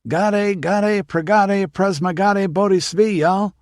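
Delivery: high, loud country twang; ranch country twang. A narrator's reading. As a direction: chant the nonsense words in a strong ranch twang. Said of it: "in a strong ranch country twang".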